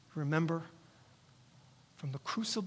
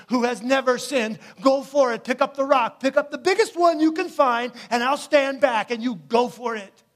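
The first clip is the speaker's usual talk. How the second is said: defiant challenge; 5 semitones higher, 11 dB louder than usual. Acted. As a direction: dare them, louder with each line. Jesus challenges the Pharisees.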